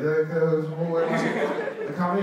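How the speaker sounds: a breaking, laughing host voice; Jimmy Fallon impression. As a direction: lightly imitate the host, laughing through it. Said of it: "Jimmy's just like".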